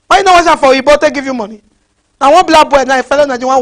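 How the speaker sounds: ordinary talk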